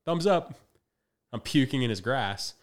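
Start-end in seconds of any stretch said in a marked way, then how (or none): 0.0-0.5 high, loud, cheery calls of all is fine; upbeat reassurance. Retold or imitated. Coach loudly reassuring his puking athlete, high and cheery. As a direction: brightly sell that everything is okay.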